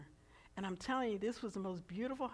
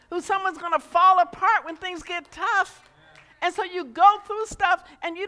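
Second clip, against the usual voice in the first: loud, rousing sermon voice, higher than the story; preaching; 10 semitones higher, 14 dB louder than usual. Acted. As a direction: preach it at full force to the room. Preaching to the congregation.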